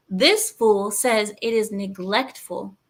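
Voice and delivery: normal talking voice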